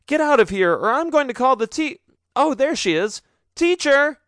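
a boy fakes seeing the teacher to escape; bluffing call for the teacher. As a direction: shout past the bullies as if she's there. Nathan bluffs, calling the teacher.

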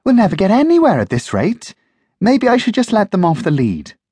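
high, fast exasperated voice; girl fed up with her tangled dogs. Acted. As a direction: huff at the tangled puppies. Jackie frustrated by the tangle.

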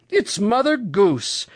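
high, drawn-out delighted recognition; excited cry. A narrator's reading. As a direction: exclaim it slowly, pitched well up. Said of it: "cried Uncle Wiggily Longears".

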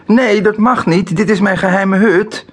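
hoge, luide huilschreeuw van een kind; schreeuwend. A narrator's reading. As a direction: gil het protesterend uit. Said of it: "schreeuwt Knofje". (huilerig schreeuwend kind)

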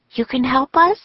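put-on high girl's voice, rising question; Annie. A narrator's reading. Annie asking the ninjas for help, high hopeful question. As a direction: ask hopefully in a high character voice.

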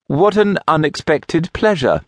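forced politeness. A narrator's reading. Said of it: "switched to politeness".

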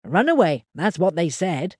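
a simple countryman telling what happened; lightly voiced old farmer; Henry the farmer. A narrator's reading. Henry's voice continues.

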